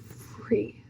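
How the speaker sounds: slow, voice giving out into whisper; moved, hushed and drawn out; emotional half-whisper. Real, not acted. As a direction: let the words fade into a slow whisper. Moved, repeating her release.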